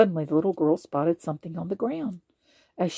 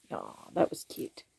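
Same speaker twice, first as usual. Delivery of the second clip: whisper; 9 dB quieter than usual. Real, not acted. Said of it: "reader's aside after story".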